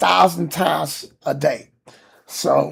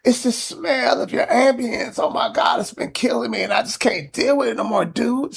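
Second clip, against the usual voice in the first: imitating the neighbor; 7 semitones higher than usual. Neighbor at the door complaining about the smell, high mock whine. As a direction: play the fed-up neighbor, pitched high. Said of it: "She's like".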